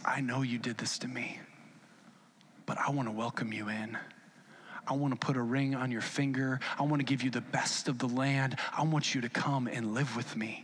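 low, soft, tender welcome; gentle forgiveness. Acted. Joseph welcoming the brothers who wronged him, gentle forgiveness. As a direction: drop down low and soft to reassure.

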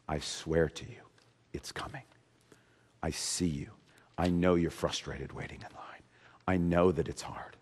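hushed, breathy whisper of comfort; whispered promise. Acted. God speaking to the weary listener, in a whisper. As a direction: whisper it close, like a secret. God's promise voiced as hush.

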